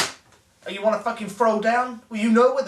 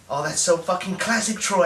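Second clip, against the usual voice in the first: ordinary delivery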